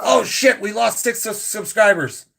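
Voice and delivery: high, hammed-up dismay; mock alarm. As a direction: cry out in pretend panic. Pretend alarm at losing subscribers.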